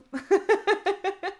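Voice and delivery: rire aigu et franc; éclate de rire; éclat de rire. Real, not acted. Rit de bon cœur. (rire ravi)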